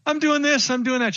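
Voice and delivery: squeaky mock outrage; indignant protest. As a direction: protest like a wounded husband who thought he was doing great. Husband protests his wife's verdict.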